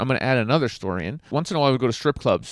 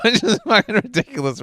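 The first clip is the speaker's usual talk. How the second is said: laughing; 9 semitones higher, 5 dB louder than usual. Real, not acted. Laughing at own story.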